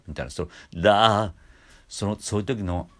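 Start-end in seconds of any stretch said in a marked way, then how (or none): 0.8-1.3 loud creaky だ; fry onset scooped upward; edge voice. Shown as a demonstration. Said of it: "使い方がエッジボイス".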